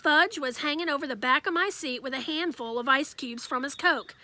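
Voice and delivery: usual speaking voice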